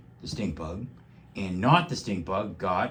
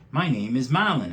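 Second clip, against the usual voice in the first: bunny voice; 5 semitones higher, 7 dB louder than usual. Acted. Bunny Marlon speaks.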